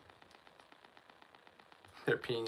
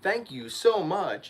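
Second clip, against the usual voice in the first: high, slow, extra-sweet thank you; polite thanks; 7 semitones higher, 7 dB louder, slower than usual. Retold or imitated. Showing the rude man how to thank the waitress, sweetly. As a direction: gush your thanks to the waitress. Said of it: "I would be like".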